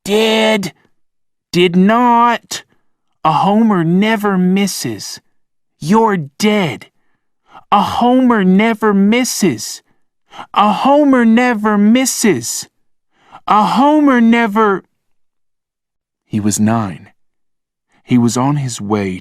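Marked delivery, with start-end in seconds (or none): 0.0-0.7 high, loud single word; stubborn kid retort. A narrator's reading. Rob insisting he was missed, high stubborn retort. 1.5-14.8 high, loud, rising insistence; insistent child shout. A narrator's reading. Logan insisting his Homer never misses, high loud kid. Boy insisting in playground quarrel.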